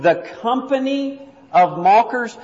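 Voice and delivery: "The company of mockers" lifted and set apart; pointing out the phrase. Shown as a demonstration. Teacher drawing the class to the last words, set apart. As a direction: quote the phrase as the problem spot. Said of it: "look at the very end".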